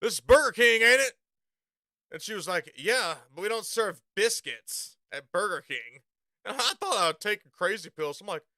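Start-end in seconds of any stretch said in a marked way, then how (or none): 0.0-1.1 incredulous loud retort. Retold or imitated. 2.8-6.0 slow, lightly voiced prim cashier; a hint of a snooty clerk; snotty cashier. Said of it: "Everyone's real fucking snotty".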